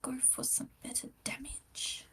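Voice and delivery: whispered plea for better damage. Real, not acted. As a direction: breathe it to the dice like a wish. Whispering a wish to the dice.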